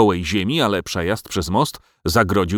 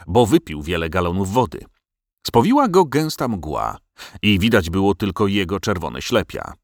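plain reading voice

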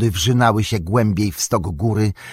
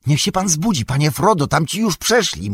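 higher, urgent insisting call; Sam insisting; 7 semitones higher than usual. A narrator's reading. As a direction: press him to wake up, urgently. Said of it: "Sam nalegał".

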